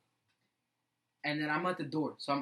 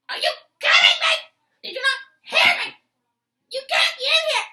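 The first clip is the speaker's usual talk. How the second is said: very high, loud imitated shriek; teen retelling the teacher yelling him out, very high and loud; teacher screaming; 14 dB louder than usual. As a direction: shriek in disbelief as the furious teacher. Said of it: "she keeps on screaming at me".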